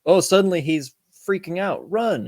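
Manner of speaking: hammed-up alarm, rising in pitch; mock alarm. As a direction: play up a sudden panic, half in jest. Acting out the movie's escape cue.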